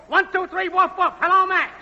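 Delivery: high-pitched mic-check holler; woofing and yelling. Acted. Technician testing the network for Max, woofing and yelling. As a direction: yell out a test call to Max. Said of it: "woofing and yelling, "Hello, Max".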